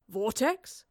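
strained, rising question. Acted. Marlowe, held by the throat, asks, strained. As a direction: squeeze out a puzzled question. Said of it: "strained Marlowe".

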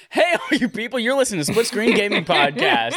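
high-pitched host greeting with laughter; hyped show intro. Acted. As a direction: announce the show big, half laughing. Restarts the hyped show opening.

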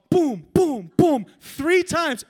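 high, loud, slow mock gunfire; booming shot imitation. Preacher re-enacting paintball hits to the head, loud dramatic booms. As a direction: punch out each shot at full volume.

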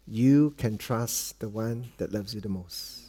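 very soft, low fatherly voice; tender reminder. Retold or imitated. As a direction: softly reassure your child, word by word. Father reminds son in the chair.

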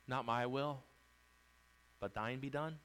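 a quiet surrendering prayer; submissive prayer. Shown as a demonstration. Praying to God in surrender, soft and low. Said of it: "but we say".

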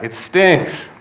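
high-pitched mock grumble; imitated exasperated reply; acted complaint. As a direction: toss back a complaint, pitch jumping up.

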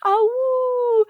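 high howling ah-woo; wolf howl. Retold or imitated. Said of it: "LaToya would know that ah-woo anywhere".

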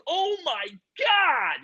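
high, drawn-out amazed cry; mock awe. Imitating everyone awed by the giant frog, slow and high. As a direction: stretch it out slow and high in wonder. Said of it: "Everyone's like".

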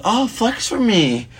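high, slow flirty mock voice; flirty shopper lady. A guy mimics a thrift store lady asking him to flex. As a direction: draw it out like a flirting stranger. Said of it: "She's like".

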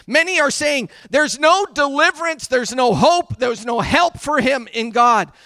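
loud, emphatic declaimed scripture; impassioned reading. Acted. Preacher rereading David's complaint to the congregation, loud and forceful. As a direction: hammer each phrase of the lament.

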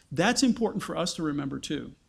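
ordinary talk

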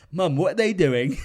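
put-on loud, high child's question in own accent; own accent, not American. Grossed-out kid asking Mom, loud in own accent. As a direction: redo the kid's cry without the American accent. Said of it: "I don't know why I said it in an American accent".

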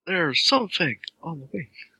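hammy overacting. Friend playing the overacting game, slow mock drama. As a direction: stretch it out like a bad actor.

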